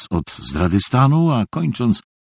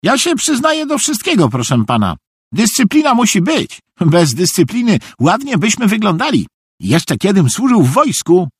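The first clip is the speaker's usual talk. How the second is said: high, eager-to-please voice; high-pitched obedient fool; eager naive simpleton; 12 semitones higher, 3 dB louder than usual. A narrator's reading. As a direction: confess everything readily in a high voice. Narrator voices naive Szwejk.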